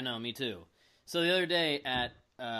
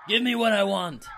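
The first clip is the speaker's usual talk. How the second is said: growled demand, pushed louder; Batista voice; 6 semitones higher, 7 dB louder than usual. Retold or imitated. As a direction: demand it like a wrestler. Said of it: "everybody on YouTube said it sounded like a weird porno".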